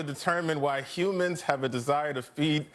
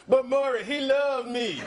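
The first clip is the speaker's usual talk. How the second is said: higher, hammed-up plea to the host; pleading talk-show guest; 5 semitones higher, 4 dB louder than usual. Republicans as cheated-on guests on Maury, hammed-up pleading. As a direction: plead like a mock betrayed lover. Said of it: "Republicans are still like".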